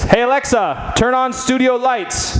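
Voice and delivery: like talking to a voice assistant across the studio; voice command. Shown as a demonstration. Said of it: "Watch this".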